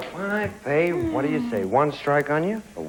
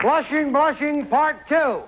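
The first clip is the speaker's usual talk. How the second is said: raised, higher game-show call; calling out answer; 8 semitones higher, slower than usual. Real, not acted. Host sends the answer to the board, calling out.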